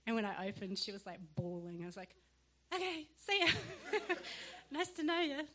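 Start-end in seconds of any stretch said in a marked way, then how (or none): 2.7-5.4 high, loud, laughing sign-off; hammed-up breezy goodbye through laughter; mock hasty farewell. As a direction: play a comic quick getaway. Acts out a hasty escape.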